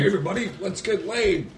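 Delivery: high, hammed-up party shout; Rodney Dangerfield. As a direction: play the rowdy party host. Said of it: "like Rodney Dangerfield".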